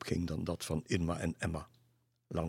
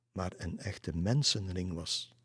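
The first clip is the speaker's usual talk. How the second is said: usual speaking voice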